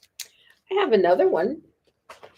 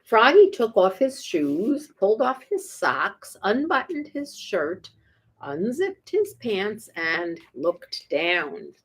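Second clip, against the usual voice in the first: usual speaking voice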